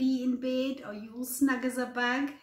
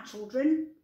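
plain reading voice

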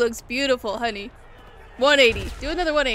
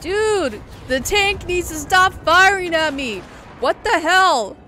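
loud, raised-pitch protest; real startled gamer outburst; alarmed exclamation; 5 semitones higher, 7 dB louder, slower than usual. Real, not acted. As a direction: yell in disbelief under fire. Tank firing at the player.

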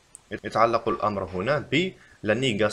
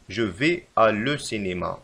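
slow, careful wrong example; slow dictation; much slower than usual. Shown as a demonstration. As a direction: read it out slowly. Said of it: "فالخطأ في هذه الجملة".

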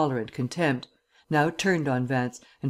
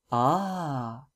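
sarcastic. A narrator's reading. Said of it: "the sarcasm of Markham's tone".

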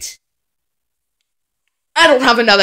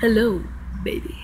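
whisper; 3 semitones lower, 11 dB quieter, faster than usual. Acted. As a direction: breathe "baby" without voice. Whispered greeting.

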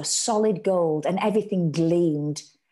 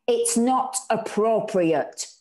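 slow, firmly raised protest; mild shout; 5 semitones higher, 3 dB louder, slower than usual. A narrator's reading. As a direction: put your foot down, slowly. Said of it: "Anna shouted".

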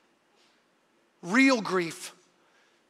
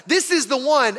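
mock crowd shout; 7 semitones higher, 6 dB louder than usual. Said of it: "That's why they shouted".

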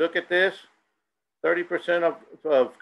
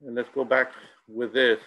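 ordinary talk

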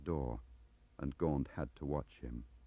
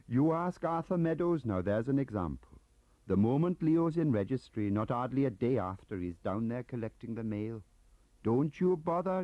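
higher, louder Welsh gossip; chatty, eager Welsh clerk; 9 semitones higher, 6 dB louder than usual. Acted. Gaunt gossiping in character.

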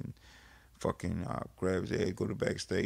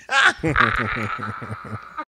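hearty laugh; 10 dB louder than usual. Real, not acted. Laugh after the story.

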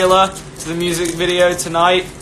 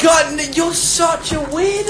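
high, exasperated complaint; fed up, voice pitched way up; 11 semitones higher than usual. Real, not acted. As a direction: scold a goofing partner in a raised pitch. Scolding the other's antics.

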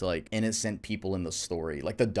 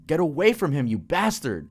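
higher, louder furious outburst; snapped, angry shout; 7 semitones higher, 5 dB louder than usual. Acted. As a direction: yell it at the monster to protect your child. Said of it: "I snapped".

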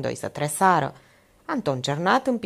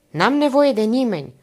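higher, louder snub; curt rebuff; 7 semitones higher, 7 dB louder than usual. A narrator's reading. Olga rebuffs her visiting aunt, curt and loud. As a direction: cut her off sharply, raising pitch and volume. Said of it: "o repezi Olga".

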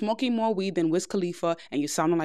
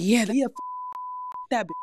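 mock rough street talk, punchy; rough girl talk; 6 semitones higher, faster than usual. Shown as a demonstration. Girl shows the rough way some women talk, mock tough. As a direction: act out a tough foul-mouthed girl. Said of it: "some girls talk like".

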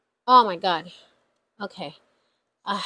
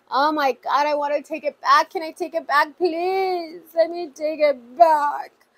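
higher, whiny retold plea to take it back; mock pleading whine; 5 semitones higher than usual. Vlogger imitating herself begging for the money back, higher and whiny. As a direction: replay her own begging in a put-on voice. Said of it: "I said".

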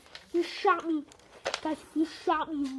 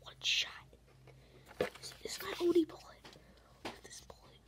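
whisper; 11 dB quieter than usual. Real, not acted. Hiding in game, whispering.

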